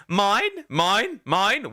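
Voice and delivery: high, loud squawking mine; seagull squawk. Retold or imitated. Mocking the seagulls in a video, high loud squawk. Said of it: "A fucking seagull from Finding Nemo".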